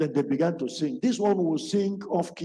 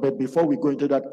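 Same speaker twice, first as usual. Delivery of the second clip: normal talking voice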